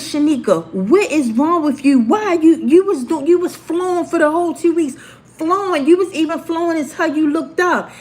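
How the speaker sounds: retold teacher's high, insistent scolding; exasperated teacher.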